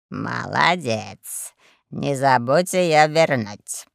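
deep, drawn-out lordly murmur; lazy boss. A narrator's reading. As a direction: approve languidly, then instruct. Boss gives lazy approval.

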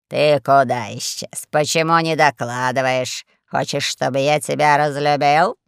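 low, heavy reproach; deep, lordly threatening questioning; scolding boss. A narrator's reading. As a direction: scold a little brother in a deep voice. Boss scolds his younger brother.

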